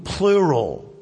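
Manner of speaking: ordinary talk, nothing marked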